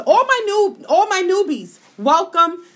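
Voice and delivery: big hyped-up welcome; excited welcome. Real, not acted. Greets new listeners on air.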